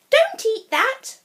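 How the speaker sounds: high loud frantic mum; alarmed mum crying no. A narrator's reading. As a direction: shriek it like a panicked mother. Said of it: "she cried".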